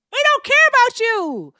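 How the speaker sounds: furious yell at the viewers; angry shout. Real, not acted. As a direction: let the words blast out.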